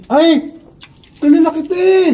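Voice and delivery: high-pitched excited stranger spotting someone; excited recognition. Retold or imitated. Stranger recognizing the speaker at a food stall, high and excited.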